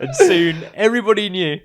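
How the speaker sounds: laughing through narration. Acted. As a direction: try to finish while laughing.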